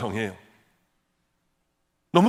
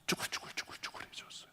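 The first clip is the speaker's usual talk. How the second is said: whisper; 15 dB quieter than usual. Retold or imitated. Hushed telling of shrunken legs.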